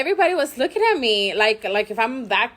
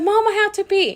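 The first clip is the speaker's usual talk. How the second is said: put-on child's voice; 10 semitones higher, 3 dB louder than usual. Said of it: "It was like".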